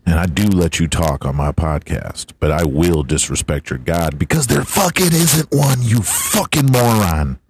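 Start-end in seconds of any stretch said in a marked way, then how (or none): none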